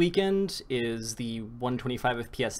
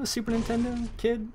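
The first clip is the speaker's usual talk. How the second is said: squeaky teasing voice; 11 semitones higher than usual. Pretend. Asking the young co-caster if he grew up on Nintendo, in a high teasing squeak. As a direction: pitch it up high to tease him about his age.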